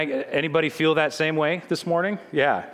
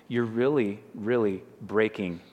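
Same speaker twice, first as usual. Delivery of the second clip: usual speaking voice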